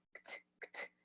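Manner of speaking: ct said twice as a bare k-t cluster; isolated kt. Shown as a demonstration. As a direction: drill the ct cluster on its own. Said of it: "vocês vão isolar esse último sonzinho".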